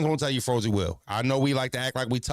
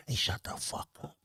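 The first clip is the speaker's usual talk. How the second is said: imitated parent's whispered warning; hushed, breathy scolding; strong whisper; 7 dB quieter than usual. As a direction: whisper it sternly at a child. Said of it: "to whisper something very strong".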